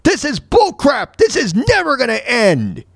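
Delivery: high-pitched retold meltdown; screaming rant. Host re-enacting his pandemic meltdown video, screaming rant. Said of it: "I'm screaming. I'm like".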